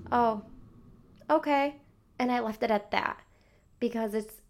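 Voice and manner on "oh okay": a high, loud startled "oh, okay"; surprised. Retold or imitated. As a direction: caught off guard but playing it cool. Mom reacts to ghost claim.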